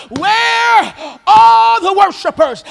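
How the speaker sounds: loud, slow, drawn-out call; loud cry. Retold or imitated. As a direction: call out like a cry back home. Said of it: "It was almost like a cry back home".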